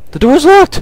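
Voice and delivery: high squeal, rising at the end; alarmed disbelief. Real, not acted. Player finding the door locked, alarmed disbelief.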